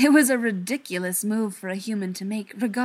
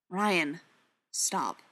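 low, restrained warning; 2 semitones lower than usual. A narrator's reading. Brendan warning Ryan to stop, voice low. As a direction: drop the voice to warn him off. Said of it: "his voice low".

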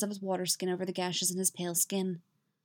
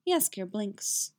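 usual speaking voice

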